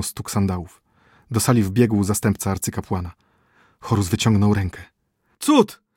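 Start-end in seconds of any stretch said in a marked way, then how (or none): 5.4-5.9 shrill high joyful cry, much louder; exclaimed. A narrator's reading. Messenger bursts into the silent hall, ecstatic shout. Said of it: "zawołał przybyły".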